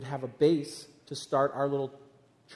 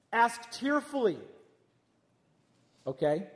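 ordinary talk, nothing marked

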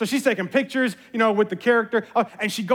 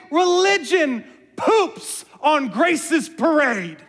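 slow punchline; 3 semitones higher, much slower than usual. Shown as a demonstration. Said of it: "That's deep, y'all".

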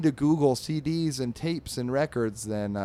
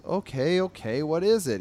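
higher, louder giving-in; mildly exasperated; 5 semitones higher, 5 dB louder than usual. Acted. Drabble getting up for his insistent dog, mildly exasperated. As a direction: lightly act a pestered dog owner.

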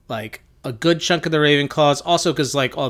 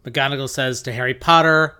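ordinary talk, nothing marked

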